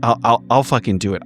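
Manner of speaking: normal talking voice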